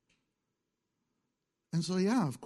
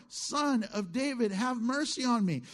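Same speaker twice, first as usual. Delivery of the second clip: crying out all the more; 6 semitones higher than usual. Acted. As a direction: push the cry up, begging harder. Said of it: "he cried out all the more".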